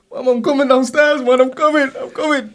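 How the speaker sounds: high hurried reply; anxious son. Retold or imitated. Son answers mum from upstairs, high nervous call. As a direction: call back that you're on your way. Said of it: "And then he goes".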